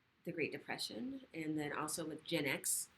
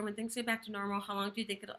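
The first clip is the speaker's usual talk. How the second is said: read too fast; 2 semitones higher, faster than usual. Real, not acted. Host hurrying through a viewer's comment over time, read too fast. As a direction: rush through the question. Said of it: "let me read that slower".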